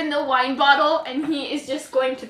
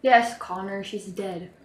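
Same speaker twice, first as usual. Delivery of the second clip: sad quiet; 5 semitones lower, 6 dB quieter than usual. Acted. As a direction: lower and much softer, grieving. Rock breaks sad news.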